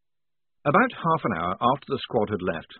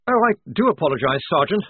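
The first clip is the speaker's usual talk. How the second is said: hasty apology; 7 semitones higher, 7 dB louder than usual. A narrator's reading. Blouse apologises hastily.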